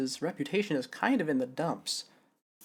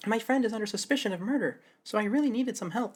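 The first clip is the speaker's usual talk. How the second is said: higher, lightly voiced young woman; a hint of Athena; 7 semitones higher than usual. Shown as a demonstration. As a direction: try a girl's voice, comes out Athena. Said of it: "That's just Athena's voice".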